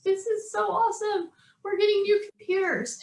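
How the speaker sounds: high-pitched, imitated childish excitement; excited kids. Kids jumping around over new computers, excited. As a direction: bounce it out like delighted kids. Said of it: "my kids are jumping up and down".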